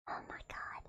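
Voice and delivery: hushed disbelieving whisper; barely voiced. Real, not acted. Streamer stunned as her face glitches again, whispering.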